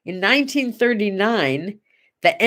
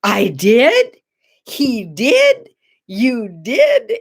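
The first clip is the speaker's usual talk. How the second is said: amazed; 2 semitones higher, 4 dB louder, much slower than usual. Retold or imitated. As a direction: echo each question in delighted disbelief. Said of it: "look at me with just total amazement".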